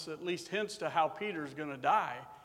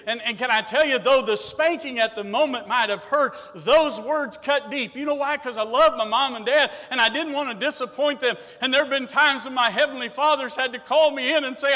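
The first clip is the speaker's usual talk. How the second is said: loud, ringing sermon delivery; full-force preaching; 8 semitones higher, 12 dB louder than usual. Acted. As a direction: preach it out to the whole church.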